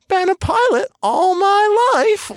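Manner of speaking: put-on high slow little boy voice; squeaky, drawn-out kid impression; Anakin. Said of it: "Anakin being like".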